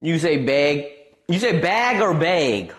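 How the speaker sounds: ordinary talk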